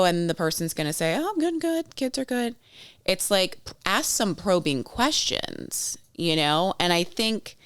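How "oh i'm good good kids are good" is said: high-pitched, airy brush-off; breezy small-talk reply. Acted. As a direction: imitate someone glossing over things in a high voice. Acts out shallow small-talk reply.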